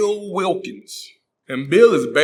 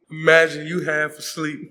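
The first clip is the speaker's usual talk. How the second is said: usual speaking voice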